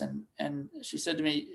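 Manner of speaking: usual speaking voice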